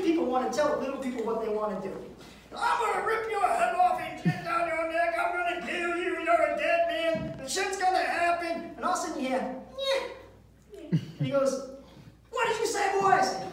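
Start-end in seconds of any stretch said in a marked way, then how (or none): 2.6-8.7 mock threat. Big man threatening the teller. 9.8-10.8 squeaky yeah. Retold or imitated. Friend's voice from behind. 12.3-13.1 mock menacing. Big man confronting.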